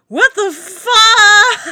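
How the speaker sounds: drawn-out, high, loud what the fuck; exasperated shout. Retold or imitated. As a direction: shout it out in disbelief. Retold outrage at the film.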